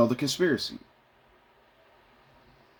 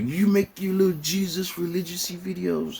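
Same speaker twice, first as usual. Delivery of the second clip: much higher mocking girlfriend voice; girlfriend teasing; 8 semitones higher than usual. Imitating his girlfriend teasing about his videos. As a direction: tease him in a higher voice. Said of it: "she's like".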